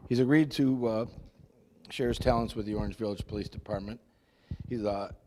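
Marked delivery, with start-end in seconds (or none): 0.1-4.0 louder, projecting to the room; speaking up. Real, not acted. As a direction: raise the voice so everyone hears. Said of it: "Talk louder, please".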